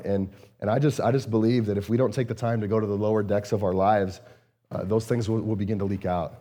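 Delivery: normal talking voice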